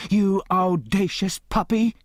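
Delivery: high, sharp scolding cry of an offended aunt; indignant outburst. A narrator's reading. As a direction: snap at him, shocked and outraged. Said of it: "she jumped up and wiped it off".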